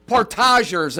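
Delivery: Portagers as por-TAH-zhers; Portagers Frenchified, stress on TAH; French-style pronunciation. Shown as a demonstration. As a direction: say Portagers with a French "tahzh" in the middle. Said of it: "if you're from France".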